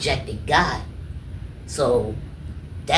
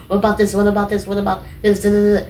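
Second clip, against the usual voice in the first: eager pestering; 7 semitones higher, 4 dB louder, faster than usual. Retold or imitated. Eager questions to God.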